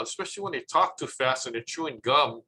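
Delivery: normal talking voice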